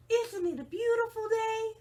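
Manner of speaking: cheerful Rosie. Acted. Rosie chirps at the cactus who ignores her, high and cheery. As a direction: gush about the lovely day. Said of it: "Rosie the tumbleweed".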